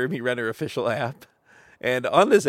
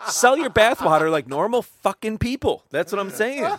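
high, loud, laughing outburst; laughing agreement; 11 semitones higher, 4 dB louder than usual. Real, not acted. Co-host agreeing about selling bathwater, laughing hard. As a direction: join the rant while cracking up. Co-host cracking up, joining the rant.

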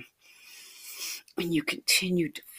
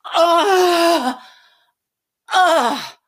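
high, loud wordless cries; ecstatic, drawn-out scream; sounds of pleasure; 12 semitones higher, 12 dB louder than usual. Acted. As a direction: cry out long and high in pleasure. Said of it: "make sounds of pleasure".

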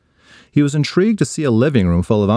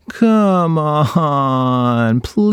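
high whining plea; energetic pleading; 4 semitones higher, much slower than usual. Acted. Ryan begging Mom again, high energetic plea. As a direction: beg with all your energy. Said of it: "throw as much energy as he could".

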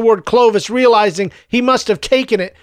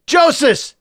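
mother calling; 6 semitones higher than usual. A narrator's reading. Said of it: "It was his mother".

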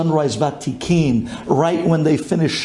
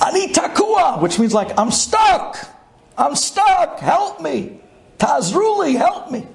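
desperate plea; 11 semitones higher, slower than usual. Retold or imitated. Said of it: "he screams".

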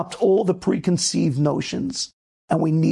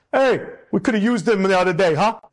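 Italian guy. Retold or imitated. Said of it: "some Italian guy was like".

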